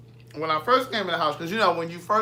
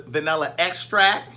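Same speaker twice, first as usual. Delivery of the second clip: EXTRACT said louder with a rising end; stress on extract; 5 dB louder than usual. Shown as a demonstration. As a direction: punch "extract" and lift it at the end. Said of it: "Not vanilla abstract".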